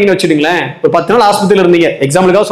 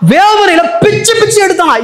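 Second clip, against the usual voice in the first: preaching shout; 10 semitones higher than usual. Real, not acted. Preacher dramatizing Ezra's anguish.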